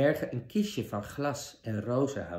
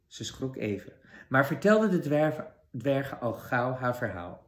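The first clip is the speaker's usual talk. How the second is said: ordinary delivery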